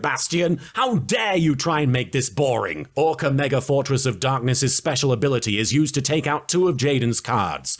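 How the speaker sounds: ordinary talk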